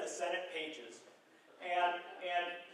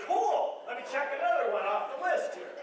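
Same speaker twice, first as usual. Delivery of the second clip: higher, upbeat and unbothered; breezy Biden; 6 semitones higher, 4 dB louder than usual. Retold or imitated. The president cheerfully answers heckling Republicans, upbeat and unbothered.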